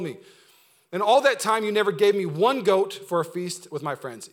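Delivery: loud, aggrieved protest to father; angry older brother. Acted. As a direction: lay out a grievance at volume. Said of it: "the older brother was angry".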